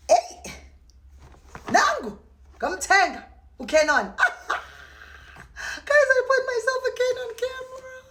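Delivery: high, loud squeals of joy; ecstatic squeal. Real, not acted. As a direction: shriek with delight at the camera. Unboxing the new camera.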